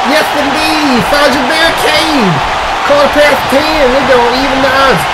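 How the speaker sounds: excited shout. Acted. Hype call in wrestling commentary.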